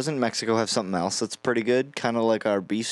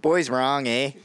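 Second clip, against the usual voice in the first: higher-pitched Canadian waitress imitation; mock Canadian accent; 5 semitones higher, slower than usual. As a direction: play up a Minnesotan-sounding Canadian accent. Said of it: "just like a Minnesotan accent, basically".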